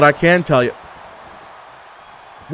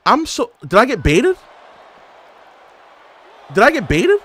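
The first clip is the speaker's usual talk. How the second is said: incredulous; 5 semitones higher than usual. Real, not acted. Disbelief at the game.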